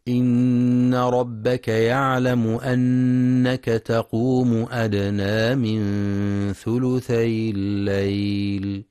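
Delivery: slow, drawn-out tajweed chanting; تلاوة مجودة بطيئة; Quran recitation. Acted. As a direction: chant the verse slowly with long vowels.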